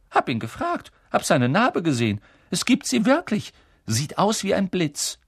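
put-on high, lively boy's voice, proud of the news; eager twin boy. Twin telling his sister about the scar, high and eager. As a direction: tell it like a boy bursting with gossip.

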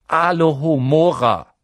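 plain reading voice

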